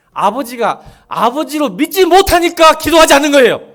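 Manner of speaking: fervent preaching. Acted. Pastor pleading with the congregation, full-force preaching. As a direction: preach it out with rising fervor. Impassioned sermon climax.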